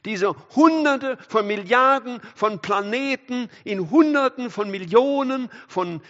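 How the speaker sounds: loud, high-pitched astonished proclamation; preacher's incredulous, rising shout; full-force preaching. Real, not acted. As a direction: cry it out in amazement, stretching the big words.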